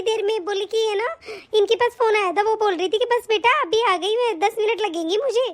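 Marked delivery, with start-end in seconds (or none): none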